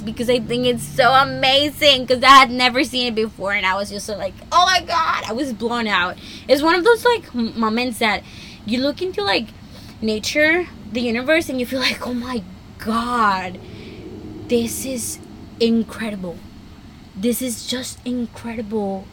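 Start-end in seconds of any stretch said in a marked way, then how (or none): 4.5-5.2 high-pitched retold gasp of amazement; amazed exclamation. Podcaster reliving first sight of snow, amazed. Acting out her amazed reaction. 12.0-19.0 awestruck. Acted. Voicing awe at nature.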